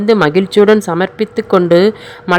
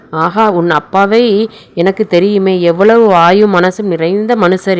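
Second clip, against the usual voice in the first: ordinary delivery, nothing marked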